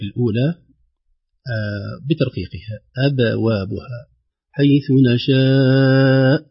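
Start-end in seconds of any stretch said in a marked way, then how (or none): 4.6-6.4 حيث نشاء with the madd held long before the hamza; حيث نشاء drawn out very slowly. Shown as a demonstration. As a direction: stretch نشاء slowly, a light push on the hamza. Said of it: "لنا ملحوظتان ... المد".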